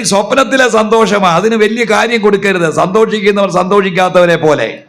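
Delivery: high, loud fervent sermon; fervent pulpit preaching. Real, not acted. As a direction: preach at full force. Full-force preaching.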